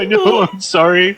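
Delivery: tearful apology. Real, not acted. Host apologizing to co-host for crying, tearful. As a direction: apologize through real tears. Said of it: "I'm trying not to cry".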